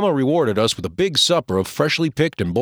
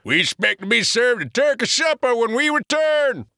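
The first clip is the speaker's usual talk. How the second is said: big voice; 11 semitones higher than usual. Acted. Big Joe continues.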